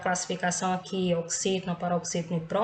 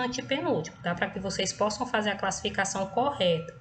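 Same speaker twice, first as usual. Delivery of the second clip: normal talking voice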